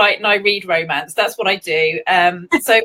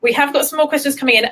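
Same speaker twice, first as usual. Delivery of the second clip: ordinary talk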